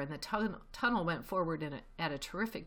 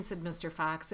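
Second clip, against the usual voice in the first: usual speaking voice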